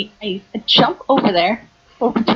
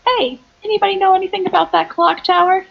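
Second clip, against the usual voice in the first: high, raised calling voice; mock yell; 9 semitones higher, 4 dB louder than usual. Slamming open the door on the police, high mock yell. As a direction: call out to a whole back room. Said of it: "you yell into the back".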